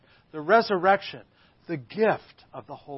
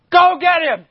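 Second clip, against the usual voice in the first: high, loud barked command; furious priest yelling for his guards; enraged chief priest; 12 semitones higher, 14 dB louder than usual. Acted. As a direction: shout the order in acted fury. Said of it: "it's with that kind of emotion".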